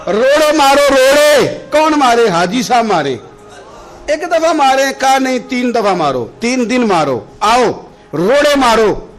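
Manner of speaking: loud, high preacher's call; fervent preaching. Acted. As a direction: drive the point home loudly. Preacher pounding the point. (بلند آواز میں پرجوش وعظ)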